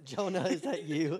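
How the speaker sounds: chuckling through the line; laughing repeat. Real, not acted. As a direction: laugh while echoing the joke.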